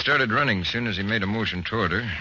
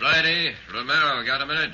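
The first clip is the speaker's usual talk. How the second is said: raised, higher call across the office; 9 semitones higher than usual. Acted. Chief calls his two detectives over, raised voice.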